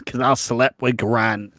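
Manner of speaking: loud, slow soap-opera confession; mock confession. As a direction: drag out the dramatic reveal. Acts Sharon's dramatic confession.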